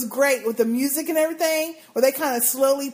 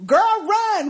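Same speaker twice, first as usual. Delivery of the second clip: retold yell; 7 semitones higher, 5 dB louder than usual. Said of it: "I was yelling at the screen going".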